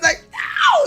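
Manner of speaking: laughing shout. Real, not acted. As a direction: bursts out shouting no through a laugh. Outburst at old lady's purchase.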